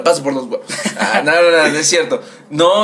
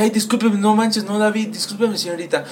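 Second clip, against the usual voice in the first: higher apology that trails off softer; flustered apology, fading; 6 semitones higher, 3 dB quieter than usual. Retold or imitated. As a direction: start pitched up and let it fade out. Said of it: "me disculpé y dije". (disculpa aguda que se apaga)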